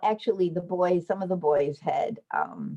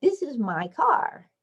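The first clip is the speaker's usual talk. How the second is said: put-on high little-girl claim, rising at the end; child's voice; 7 semitones higher than usual. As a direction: say it like a proud preschooler. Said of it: "Just like".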